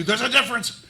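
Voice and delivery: high, loud outburst of protest; indignant scream. Acted. Game master as an offended crocodile correcting the player, screaming. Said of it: "He screams at you".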